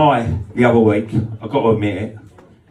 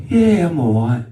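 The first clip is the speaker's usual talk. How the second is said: lightly voiced woman; 9 semitones higher than usual. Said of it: "She went".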